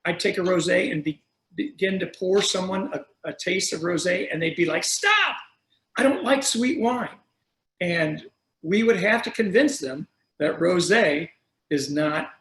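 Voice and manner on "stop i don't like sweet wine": imitated customer's high-pitched refusal; hammed-up objection, pitched way up; mock protest. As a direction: cut them off with a high, playful "Stop!". Acting out customers refusing rosé.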